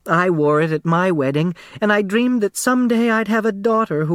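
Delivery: put-on a small, round seamstress selling her old shop; eager dressmaker. Dressmaker talks of family lace.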